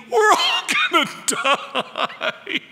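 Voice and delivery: high, laughing cry of panic; hammed-up disciples screaming in fear; mock panicked scream. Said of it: "as they scream".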